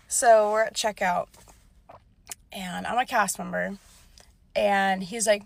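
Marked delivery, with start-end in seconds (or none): none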